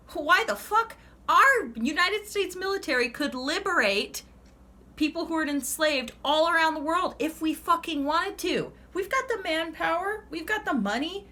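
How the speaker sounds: high, loud and fired up; heated rant. Real, not acted. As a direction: rant at the top of your voice. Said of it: "I'm getting on a rant".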